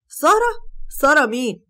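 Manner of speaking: high, loud, startled double take on the name; stunned, he doesn't recognize her; shocked. A narrator's reading. Boss sees the made-over girl, high startled disbelief.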